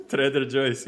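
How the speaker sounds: laughing, Joe's as "Joyce"; funny mispronunciation. Shown as a demonstration. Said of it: "I'll forever call it".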